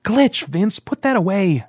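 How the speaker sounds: high, urgent command; sharp order. A narrator's reading. Rand ordering his hot-headed friend to drop the blaster, high and urgent. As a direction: snap him out of it. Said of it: "Rand ordered".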